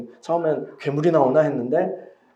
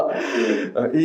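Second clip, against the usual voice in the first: usual speaking voice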